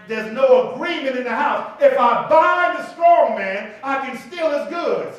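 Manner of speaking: loud, slow preaching with a shouted end; full-force preaching. Acted. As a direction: preach it hard and drawn out.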